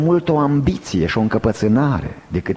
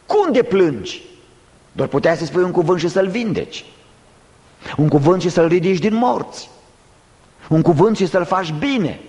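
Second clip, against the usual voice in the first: raised-pitch reproachful questioning; accusing challenge; 5 semitones higher than usual. Acted. Preacher voicing those who doubt Christ's tears, accusing challenge. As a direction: challenge him, each phrase pitched up high. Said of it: "aceasta a fost acuzația".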